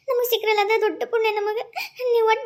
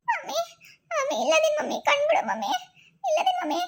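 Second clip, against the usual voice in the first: tearful; 6 semitones higher, 4 dB quieter than usual. Acted. Daughter sees mother awake.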